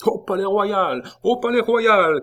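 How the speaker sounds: high, loud rallying cry; crowd shouting. A narrator's reading. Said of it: "cria la foule".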